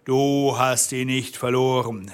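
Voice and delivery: ordinary delivery, nothing marked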